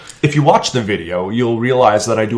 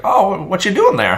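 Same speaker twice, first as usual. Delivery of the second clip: put-on higher, friendly old man; old man's voice; 10 semitones higher, 4 dB louder than usual. Passing old man greeting vlogger, lightly voiced. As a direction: play a chatty old neighbor. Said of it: "he's like".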